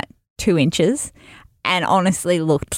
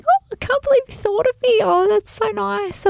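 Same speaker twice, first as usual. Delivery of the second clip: gushing delight. Retold or imitated. Imitating the pregnant friend thanking her, high delighted gush. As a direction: squeal it like you're touched and thrilled.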